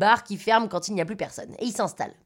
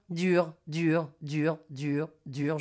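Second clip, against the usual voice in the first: lower, softer, sinking refrain; drawn-out repetition; 5 semitones lower, 5 dB quieter, much slower than usual. A narrator's reading. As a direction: let each repeat sink lower as time drags. Repetition mimics conversation dragging on.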